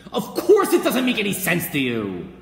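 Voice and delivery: loud, high, incredulous outburst; exasperated shout. Acted. Preacher answering a doubter who finds no sense, exasperated shout. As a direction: explode at the objection. Shouts back at imagined objector.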